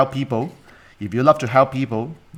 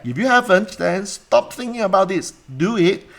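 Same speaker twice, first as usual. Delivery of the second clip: raised, insistent urging; emphatic push; 9 semitones higher, 4 dB louder than usual. Real, not acted. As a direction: push them to act now. Coach urging students to act.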